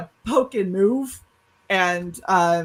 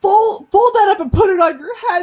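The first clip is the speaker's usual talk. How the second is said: high, loud impatient order; hammed-up parental scolding; yelling mom; 12 semitones higher, 7 dB louder than usual. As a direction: imitate a fed-up mother snapping instructions. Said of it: "Why are you yelling at me".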